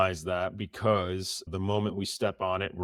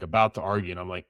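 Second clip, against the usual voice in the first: usual speaking voice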